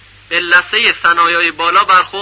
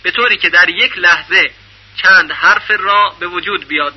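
ordinary talk

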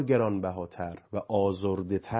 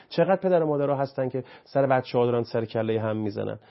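ordinary delivery

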